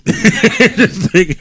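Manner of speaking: drawn-out amused interesting; laughing. Real, not acted. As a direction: stretch it out through a laugh. Host laughs at guest's story.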